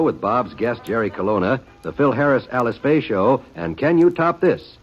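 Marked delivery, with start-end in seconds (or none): none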